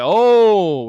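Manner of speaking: loud, high mock gasp of the crowd. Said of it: "everyone was like".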